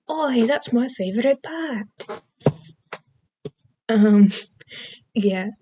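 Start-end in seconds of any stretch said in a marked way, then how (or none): 0.1-1.9 put-on higher, softer Scottish accent; dad from Brave. Vlogger quoting the dad from Brave, Scottish accent. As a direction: lilt it like a Scottish film dad. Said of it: "from the dad from Brave, he said".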